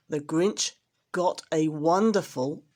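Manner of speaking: ordinary delivery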